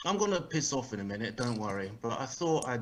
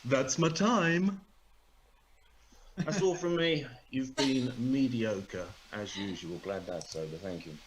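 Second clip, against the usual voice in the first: deadpan insult the audience on the way out; mock dismissive sign-off. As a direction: comedian ending his act with a fake put-down. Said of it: "finish my act in an original way".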